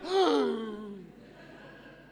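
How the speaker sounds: sharp gasp of amazement; astonished gasp. Retold or imitated. Disciples seeing the risen Jesus, a sharp astonished gasp. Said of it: "like that".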